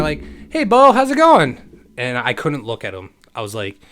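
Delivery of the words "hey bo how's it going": high, loud, drawn-out hello; sing-out welcome, much raised and slowed; cheery called greeting. Retold or imitated. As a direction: imitate parents calling out a carefree greeting. Parents' greeting on his return home.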